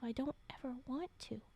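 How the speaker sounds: soft secret whisper. Acted. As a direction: whisper the thought she won't say aloud. Character's secret inner thought.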